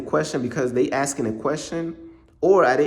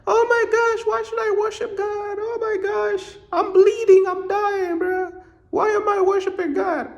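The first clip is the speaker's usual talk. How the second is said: high falsetto whiny mock sobbing, much higher; mock crying. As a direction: ham up a grown man wailing, squeaky and high. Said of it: "These dudes crying about".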